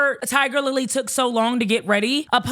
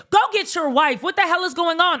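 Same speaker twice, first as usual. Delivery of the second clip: higher-pitched imitation of a fed-up brother; mock-angry, impatient demand; pissed off; 6 semitones higher than usual. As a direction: act out an irritated brother. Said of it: "the brother is pissed".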